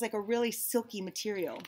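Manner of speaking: usual speaking voice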